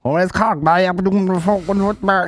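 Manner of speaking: high, mock West Cork accent; a light put-on Cork accent. The Cork fella chatting in the toilet, Cork accent. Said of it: "this Cork accent".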